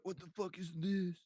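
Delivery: lazy, dazed stoner voice. Retold or imitated. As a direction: sound high and confused. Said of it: "that's my stoner voice right there".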